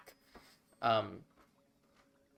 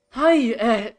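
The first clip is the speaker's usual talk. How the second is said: higher, louder, bubbly gnome voice; eager gnome cleric; 7 semitones higher, 6 dB louder than usual. Acted. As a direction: gush like a flustered fan meeting heroes. Gnome priestess introduces herself.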